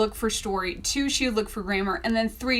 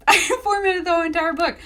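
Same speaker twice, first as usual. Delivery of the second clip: laughing through it, higher and fast; 8 semitones higher, 5 dB louder, faster than usual. Real, not acted. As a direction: laugh it out in disbelief at yourself. Laughing at her own madness.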